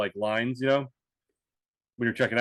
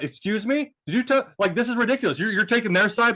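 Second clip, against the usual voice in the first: higher-pitched mock indignation; indignant rant; 8 semitones higher than usual. Retelling a shopper accusing someone of taking sides, indignant rant. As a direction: imitate an offended shopper ranting. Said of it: "she started ranting".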